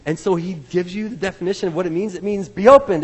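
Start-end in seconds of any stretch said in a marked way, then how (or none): 2.5-3.0 loud, commanding burst; forceful command. Acted. Jesus commands the deaf man's ears, a loud order. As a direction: order it open at full force. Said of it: "It's a command".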